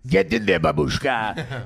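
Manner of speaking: hammed-up bad Russian accent, last word drawn out; terrible Russian accent. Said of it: "doing terrible Russian accents".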